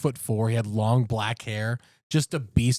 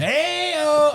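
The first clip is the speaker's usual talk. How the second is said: hype cheer; 14 semitones higher, 5 dB louder than usual. Real, not acted. Patreon plug cheer.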